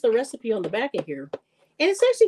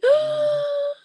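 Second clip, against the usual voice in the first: excited gasp. Real, not acted. Delighted gasp at the returning viewer.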